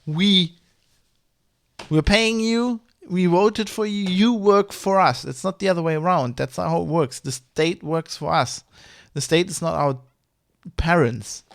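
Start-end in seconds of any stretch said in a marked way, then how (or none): 0.1-6.4 heated rant. Real, not acted. Ranting at politicians.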